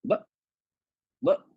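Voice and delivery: ब short, ending in a glottal stop; voice shut off. Shown as a demonstration. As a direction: let no sound escape after each ब. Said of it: "बाहर ही नहीं आएगी". (ब के बाद आवाज बाहर न आए)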